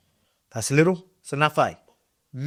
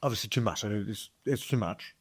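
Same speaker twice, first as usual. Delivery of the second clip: mouth full; 5 semitones lower, 12 dB quieter than usual. Real, not acted. Mouthful of caked Vegemite.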